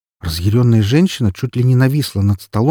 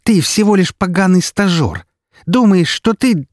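contemptuous anger; 10 semitones higher, 4 dB louder than usual. Acted. Yudina insults the intern.

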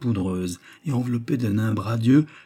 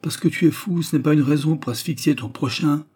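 higher-pitched mild scolding; lightly grumbled protest, pitch raised; grumbling; 6 semitones higher, 3 dB louder than usual. A narrator's reading. Old man telling off the happy youth who squeezes him, grumbling. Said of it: "grommela le bonhomme".

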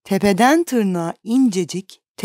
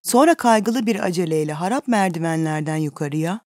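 plain reading voice

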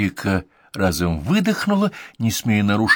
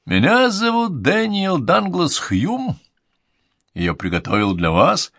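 stage showman; 9 semitones higher than usual. Acted. Medium addresses audience.

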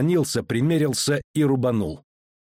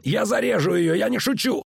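yelling; 10 semitones higher than usual. A narrator's reading. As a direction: scream the threat to keep him back. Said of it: "заорал он".

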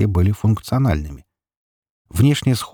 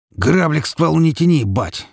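high, tense warning dropping on the last word; threatening; 8 semitones higher than usual. A narrator's reading. Petka holding his father at gunpoint, threatening. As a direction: warn him off with menace. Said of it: "угрожающим тоном сказал Петька".

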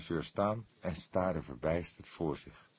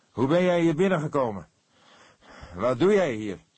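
loud, higher interrogation; sharp questioning; 9 semitones higher, 9 dB louder than usual. A narrator's reading. The cook interrogates the intruder, loud. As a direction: fire questions at him.

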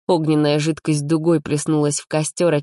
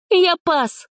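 put-on very high, crisp girl's no; high firm refusal; quick refusal; 14 semitones higher, 3 dB louder than usual. A narrator's reading. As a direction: wave it off. Alina refuses.

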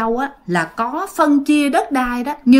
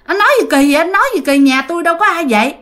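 higher-pitched indignant retort; father protesting the old man's claim, voice raised; retold indignant protest; 6 semitones higher, much faster than usual. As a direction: push back hard, voice raised high. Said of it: "ba của bạn mới cự lên liền".

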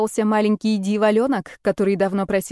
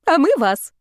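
higher, louder playful interjection; suddenly chiming in; 7 semitones higher, 5 dB louder than usual. A narrator's reading. Ксюша suddenly joining the prank confession, chiming in high. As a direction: jump in abruptly, pitched up. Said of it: "вдруг включилась Ксюша".

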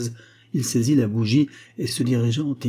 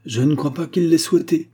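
high, loud, plain-spoken objection; frank; 7 semitones higher, 6 dB louder than usual. A narrator's reading. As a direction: say it outright. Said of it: "dit Agar sans embâge".

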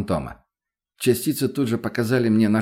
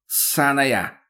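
drawn-out, higher jeer at modest volume; taunting call; 5 semitones higher than usual. A narrator's reading. Addict jeering back at Лена through a snowdrift, calling out. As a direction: call the insult across a short distance. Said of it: "крикнул чуть издали наркоман".

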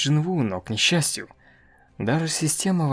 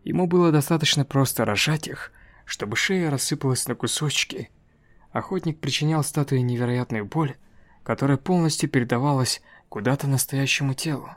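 ordinary delivery, nothing marked

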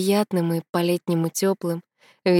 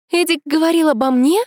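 high, louder gasping question; 10 semitones higher, 5 dB louder than usual. A narrator's reading. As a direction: gasp it out in shock. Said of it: "ахнула Тома".

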